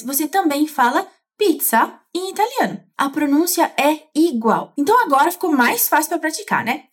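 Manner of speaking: ordinary talk, nothing marked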